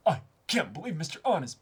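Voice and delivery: excited character voice. Acted. Starstruck underling gushing over his boss, lightly acted.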